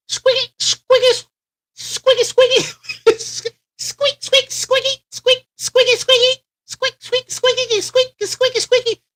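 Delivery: very high squeaky scratching sounds; playful high-pitched scratch imitation; record scratch noise. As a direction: squeak it out like a needle scratching vinyl.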